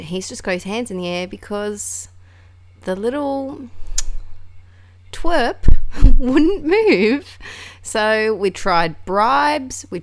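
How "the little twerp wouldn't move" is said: high, loud, drawn-out exasperation; exasperated. Retold or imitated. Telling how the bunny refused to budge, loudly exasperated. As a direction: stretch it out, loud and high, half laughing.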